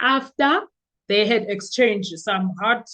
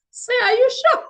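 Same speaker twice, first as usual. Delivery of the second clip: high, loud disbelieving question; incredulous question; 12 semitones higher, 4 dB louder than usual. Retold or imitated. Courtroom watchers doubting the tea story, incredulous. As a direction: ask it in mock disbelief. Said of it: "we were like".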